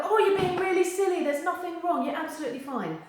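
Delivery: high, loud mock brush-off; hammed-up patronizing reassurance; mock dismissive. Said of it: "don't go".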